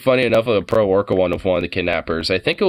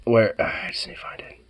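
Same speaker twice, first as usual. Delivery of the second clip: whispering; 4 dB quieter than usual. Real, not acted. Muttering while searching for a clip.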